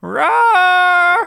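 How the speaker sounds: high loud monster roar; Godzilla roar. Pretend. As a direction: roar like a giant lizard.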